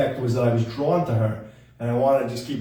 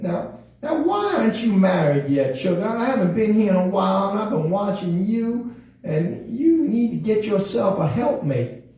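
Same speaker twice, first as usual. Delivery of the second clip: high, sassy puppet voice; nagging puppet; 6 semitones higher than usual. Pretend. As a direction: pester him about marriage in a higher voice. Puppet nags him about marriage.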